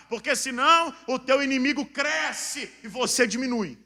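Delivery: high, loud exhortation; full-force preaching. Real, not acted. Preacher urging believers to be strong in distress, full-force preaching. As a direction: drive the congregation to courage at a shout.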